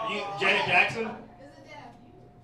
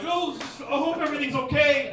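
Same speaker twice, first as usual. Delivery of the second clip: high-pitched mock fretting; frail, timid woman; 9 semitones higher, 4 dB louder than usual. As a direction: imitate a frail, worried woman in a higher voice.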